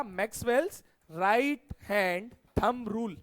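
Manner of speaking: name the rule slowly, word by word; slow and measured, each word set apart; slow, emphatic recap. Real, not acted. Teacher repeating the rule's name for students, slow and emphatic.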